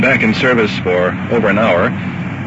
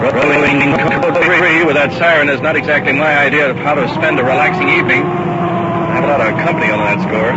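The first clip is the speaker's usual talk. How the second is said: raised voice over siren; 7 semitones higher than usual. Real, not acted. Talking over a loud siren.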